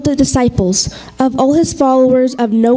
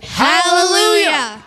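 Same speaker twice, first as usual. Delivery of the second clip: slow reverent hallelujah; 6 semitones higher, slower than usual. Acted. End of the joint proclamation.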